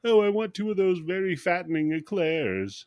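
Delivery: high-pitched lady customer voice; lady ordering eclairs. Acted. Wealthy lady orders pastries at the bakery, lightly acted high voice. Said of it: "'cause the lady's like".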